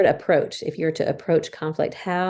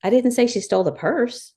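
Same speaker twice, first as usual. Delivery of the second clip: higher, didn't rising hard; stress on didn't; 7 semitones higher, 4 dB louder than usual. Shown as a demonstration. Wife demoing emphasis, high stress on didn't. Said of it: "because of the emphasis that was on it".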